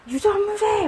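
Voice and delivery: high mock protest cry; imitating a wronged prisoner's high cry; mock wail. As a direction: cry it out from behind prison bars, half-joking. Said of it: "막 이러면서".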